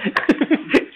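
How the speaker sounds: high, breathless giggle; giggling. Real, not acted. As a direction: keep giggling at your own joke. Keeps laughing after saying joke.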